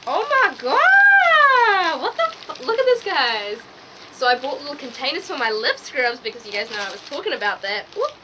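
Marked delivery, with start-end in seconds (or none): 0.1-3.0 long, very high and loud drawn-out oh my god; shocked excitement. Real, not acted. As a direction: gasp it out, stretched and high. Opening package, big reaction.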